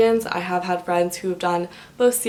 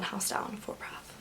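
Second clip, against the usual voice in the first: whispered; 17 dB quieter than usual. Real, not acted. Aside about a hidden option.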